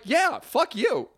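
high-pitched, drawn-out mock outrage; indignant squawk, played for laughs; retold angry retort. Acts out girl's angry reply.

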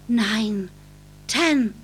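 ordinary delivery

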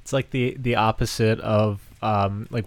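usual speaking voice

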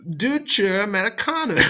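"Deutsche Amerikaner" in a high, loud, hammed-up German accent; movie villain german accent. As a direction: growl out "Deutsche Amerikaner" like a film Nazi. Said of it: "I can't do a German accent without sounding like a Nazi".

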